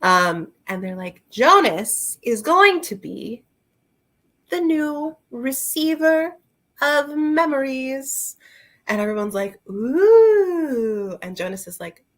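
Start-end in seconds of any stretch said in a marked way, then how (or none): 1.3-8.4 slow, much higher grand announcement; grand announcer. Retold or imitated. At the ceremony, the elder announces Jonas's job slowly and grandly. 9.7-11.2 high, loud awed ooh; a loud, high crowd ooh; crowd's ooh. Retold or imitated. The crowd hears Jonas's new job, a high loud awed ooh.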